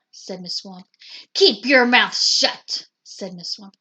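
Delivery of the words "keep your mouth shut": stern teacher. A narrator's reading. Strict teacher orders class.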